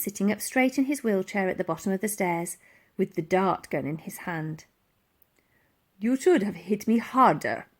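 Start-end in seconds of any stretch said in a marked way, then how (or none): 6.0-7.7 purred. A narrator's reading. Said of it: "he purred".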